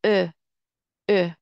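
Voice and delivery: ا as "ö", lips slightly puckered; isolated ا as ö, modelled twice; rounded front ö. Shown as a demonstration. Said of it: "دهنتون رو یکم قنچه کنید".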